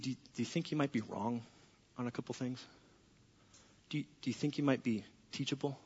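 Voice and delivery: soft, hushed and gentle; God quietly correcting Steve; leaning in close. Acted. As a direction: lean in and say it softly. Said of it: "God kinda leans in and goes".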